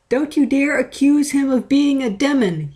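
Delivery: hoger geschreeuwd, boos en ongelukkig; unhappy yell. A narrator's reading. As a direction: verontwaardigd uitroepen, hoger en harder. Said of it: "yelled Otachi unhappily".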